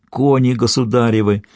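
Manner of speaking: ordinary delivery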